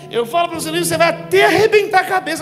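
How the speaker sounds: exasperated parental reprimand, acted; scolding. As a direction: scold in mounting repetitions, as God to a wayward child. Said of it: "Deus dando a bronca em você".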